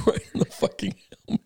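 real laugh running through the words. Real, not acted. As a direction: crack up while saying it. Laughing at the helmet hit.

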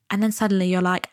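usual speaking voice

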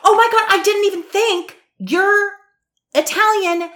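high excited realization; suddenly thrilled, pitch way up. Real, not acted. Excited realization, much higher louder.

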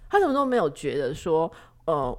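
ordinary talk, nothing marked

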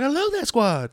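high-pitched mock Houston accent; thick Houston accent. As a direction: imitate an excited driver in Houston accent. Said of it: "everyone has the thick accent".

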